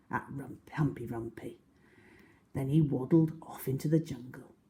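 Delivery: plain reading voice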